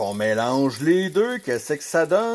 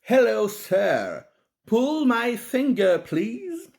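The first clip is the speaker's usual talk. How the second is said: high, slow mock British English; British accent; 7 semitones higher, slower than usual. Telling a British joke to viewers, hammed-up British accent. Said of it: "une autre blague british".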